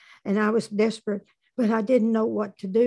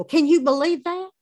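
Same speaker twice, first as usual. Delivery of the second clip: high, loud disbelief; incredulous, raised-voice protest; mock outrage; 7 semitones higher, 5 dB louder than usual. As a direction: exclaim it as if scandalized. Mock outrage at woman's jailing.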